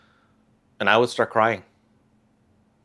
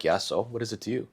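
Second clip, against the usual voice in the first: inner snark; 2 semitones higher, 8 dB quieter, faster than usual. Retold or imitated. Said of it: "Inside, I'm like".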